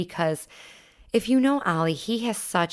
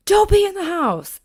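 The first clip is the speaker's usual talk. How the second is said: high, loud scolding yell; 14 semitones higher, 8 dB louder than usual. Retold or imitated. Owner scolding dog for peeing inside, high yell. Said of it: "I yelled at her".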